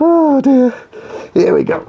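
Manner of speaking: high laughing oh dear. Real, not acted. Struggling to get up.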